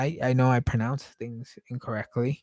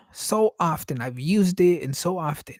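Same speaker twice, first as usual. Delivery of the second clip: normal talking voice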